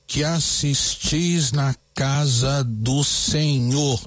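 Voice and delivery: very slow, every syllable spaced out; measured scansion read; syllable counting. Shown as a demonstration. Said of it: "Vamos contar essas sílabas".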